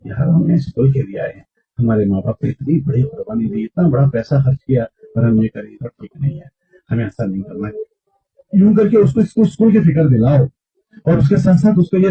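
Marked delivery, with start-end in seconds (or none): 0.1-7.7 lower, softer friendly worry; sincere concern. Shown as a demonstration. Preacher showing how to gently warn a weak student. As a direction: quietly make him feel the worry, like a friend. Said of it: "तो उसको फिक्र दिलाओ".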